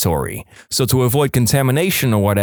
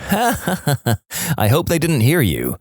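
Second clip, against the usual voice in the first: laughing; 4 semitones higher, much slower than usual. Acted. Listener voice reacts laughing.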